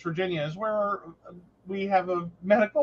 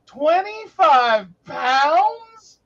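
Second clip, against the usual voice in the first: drawn-out, higher, louder disbelief; shocked double take; 7 semitones higher, 5 dB louder, much slower than usual. Retold or imitated. Retelling to her co-host, drawn-out higher shocked disbelief. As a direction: stretch it out in stunned disbelief. Wife realizing the quantity, retold.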